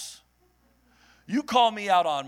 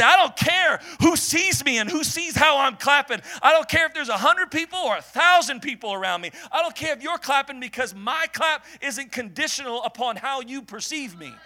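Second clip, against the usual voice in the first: higher, loud declaration of freedom; defiant shout; 5 semitones higher, 4 dB louder than usual. Retold or imitated. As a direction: proclaim it, shouting above the crowd. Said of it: "and I say".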